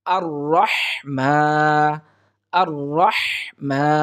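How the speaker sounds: "আর রহমা" slow, rolled heavy r and strong breathed h; correct tajweed. Shown as a demonstration. Teacher modelling the correct recitation for students, careful tajweed. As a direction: draw out "আর রহমা" with a rounded "ro" and long "maa". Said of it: "সুতরাং সহি হলো".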